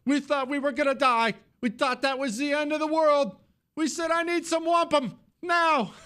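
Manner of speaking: very high falsetto mock sobbing voice; mock emotional woman. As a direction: mimic her tearful confession in an exaggerated squeal. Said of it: "Yeah, she sounds like it".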